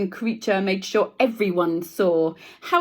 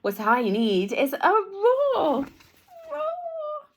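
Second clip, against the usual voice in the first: high little mouse trying a roar; a tiny mouse's attempt at roaring; squeaky mouse; 13 semitones higher, slower than usual. Teacher voicing the mouse trying out his first roar. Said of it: "he said".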